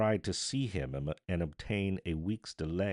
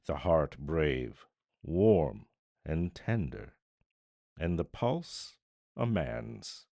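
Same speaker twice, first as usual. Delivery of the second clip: solemn declamation; 4 dB louder than usual. A narrator's reading. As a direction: proclaim it like an epitaph. Unseen voice pronouncing over the dead.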